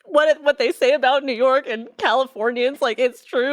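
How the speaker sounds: on the edge of tears, letting it all out; distraught wail. Retold or imitated. Retold tearful breakdown at gallery.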